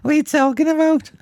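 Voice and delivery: high-pitched, badly botched American accent; hammed-up mock American; attempted American accent. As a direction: squeak out a failed American accent. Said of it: "How do I do an American accent again?".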